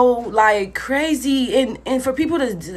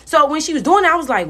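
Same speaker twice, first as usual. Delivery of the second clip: ordinary delivery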